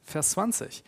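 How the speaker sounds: usual speaking voice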